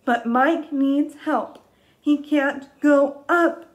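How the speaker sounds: high, loud, slow worried storybook voice; hammed-up storytime worry; dramatic read. Shown as a demonstration. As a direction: make the danger big for kids. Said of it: "Read it with me".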